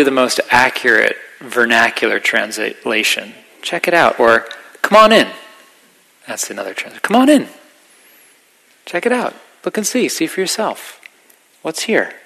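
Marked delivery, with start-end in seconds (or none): 3.6-5.3 high, loud, beckoning call; eager invitation. Shown as a demonstration. Teacher voicing the Buddha's welcome, eager and bright. 7.0-12.1 eager invitation. Shown as a demonstration. Teacher voicing the Buddha's welcome, eager and bright.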